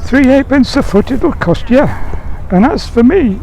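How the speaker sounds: high-pitched, unbudging haggler; stubborn high reply. Acted. Sam still refuses, nearly submerged.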